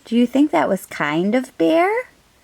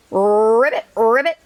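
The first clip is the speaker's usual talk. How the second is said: frog ribbits. Acted.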